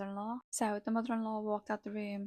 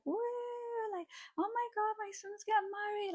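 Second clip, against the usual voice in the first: imitating a squealing, much higher mock cheer; extra loud; 13 semitones higher than usual. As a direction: gush over-the-top in a silent church. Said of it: "just being a bit extra loud".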